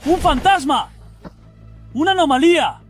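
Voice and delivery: high, terrified shout; frightened scream. Acted. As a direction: scream in terror at a ghost. Sees a ghost, cries out.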